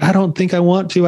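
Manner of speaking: put-on a touch of a scared child; teary little girl. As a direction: daughter on the range, lightly teary. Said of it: "teared up".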